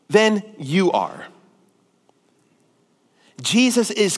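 loud, emphatic sermon voice; impassioned preaching. Real, not acted. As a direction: drive each phrase home, stretching key words. Preaches at full force.

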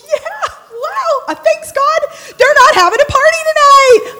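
high, loud gleeful cheer; mock jubilation. Neighbor spotting dark windows next door, high elated cheer. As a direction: celebrate way up high, building to a shout. Retold glee that party is off.

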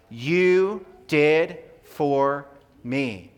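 slow, drawn-out call-and-response; broad singsong kids theatre. Shown as a demonstration. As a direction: stretch each word out for the kids to echo. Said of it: "kids, ready?".

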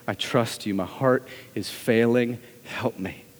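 low, subdued plea to God; pained prayer. Acted. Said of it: "in the deepest possible pain we say".

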